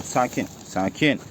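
soft, slow, calming hush; soothing the birds. Real, not acted. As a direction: settle them down gently and slowly. (kuşları yumuşak ve yavaş sakinleştirme)

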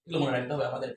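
ordinary talk, nothing marked

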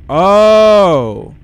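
loud sudden yelp; startled yelp. Real, not acted. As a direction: yelp in surprise. Startled in game.

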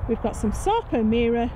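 ordinary talk

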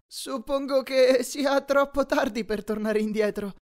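high, slow, drawn-out regret; reluctant high-voiced worrier, dragging the words; regretful sigh. A narrator's reading. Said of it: "sospirò Grover con una punta di rimpianto".

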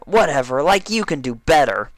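sulky retort. A narrator's reading. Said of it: "Dash shot".

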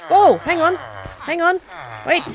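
startled, high and loud; real burst of surprise; excited shouting. Real, not acted. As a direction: call out in sudden excitement. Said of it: "Sorry, I'm shouting".

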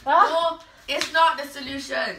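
loud, high protest. Real, not acted. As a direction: shout it down. Objecting, laughing.

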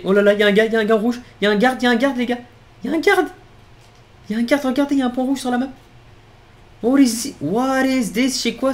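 high, loud and urgent; real alarmed shouting; excited alarm. Real, not acted. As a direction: call out the danger to your team. Spots a guard, alarms the crew.

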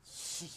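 soft breathy shush; a quiet unvoiced shh to hush. Smelly hushes as he gives back the words, soft shush.

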